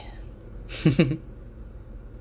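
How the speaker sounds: short unvoiced chuckle; a wry little laugh at the punishment; breathy laugh. Real, not acted. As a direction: laugh it off, amused.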